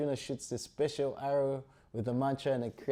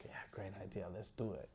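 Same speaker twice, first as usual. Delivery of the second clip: low-key, softer acted reply; casual agreement; 3 semitones lower, 14 dB quieter than usual. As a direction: agree quietly, a touch lower. Duryodhana casually agrees to plan.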